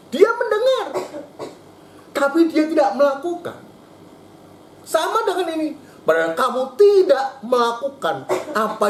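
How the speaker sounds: high-pitched fervent preaching. Real, not acted. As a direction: drive the rebuke home. Preaching climax. (khotbah berapi-api dengan nada tinggi)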